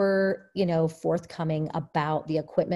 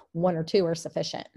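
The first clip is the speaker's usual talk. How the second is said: ordinary talk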